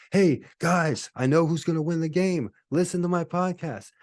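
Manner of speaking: high, pleading huckster pitch; desperate time traveler. Pretend. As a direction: sell it like a frantic street tipster. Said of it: "He'd be trying to tell people".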